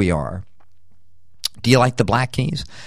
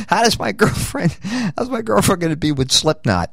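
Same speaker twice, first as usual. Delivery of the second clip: higher, fretting voice; worried boyfriend; 9 semitones higher than usual. Retold or imitated. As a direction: fret about the girlfriend, higher. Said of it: "Now you say".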